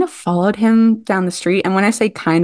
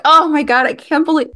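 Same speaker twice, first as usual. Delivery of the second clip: higher-pitched, mock-upset outburst; imitating near tears; 9 semitones higher, faster than usual. Upset actress beating herself up backstage, imitated near tears. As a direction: retell her distress in a raised pitch. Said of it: "on the verge of tears talking to me".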